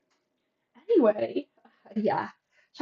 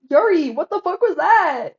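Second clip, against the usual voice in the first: slow, loud, higher outburst of disbelief; stunned shout; 6 semitones higher, 8 dB louder, slower than usual. Real, not acted. Streamer shouting at Yuri over her line, slow stunned yell. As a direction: yell it slowly in real shock. Shocked reaction to a game line.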